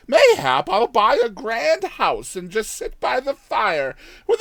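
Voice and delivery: put-on high, loud old woman dreaming of riches; daydreaming old woman. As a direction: ramble on, giddy with plans.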